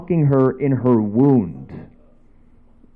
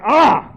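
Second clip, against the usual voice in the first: short, high, loud yelp; mock startled scream; 13 semitones higher, 6 dB louder than usual. Reacting to friend's film, high mock scream. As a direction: yelp as if suddenly shocked.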